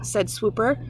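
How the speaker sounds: usual speaking voice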